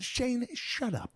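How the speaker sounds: high, drawn-out exasperated retort; mock annoyed dad. Dad fed up with his son's teasing, mock exasperation. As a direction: imitate dad's stretched-out, high-pitched dismissal. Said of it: "He's like".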